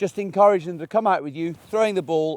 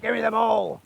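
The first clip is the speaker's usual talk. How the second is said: higher, abrupt mock grab for the ball; grabby demand; 7 semitones higher than usual. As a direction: play the impatient owner snatching it. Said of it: "it's not like".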